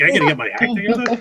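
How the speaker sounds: high-pitched disbelieving question; mock-offended rising echo; incredulous echo. Echoes her scolding in disbelief.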